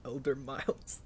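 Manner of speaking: hushed whisper of the name. Acted. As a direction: breathe it under your breath. Echo whispered.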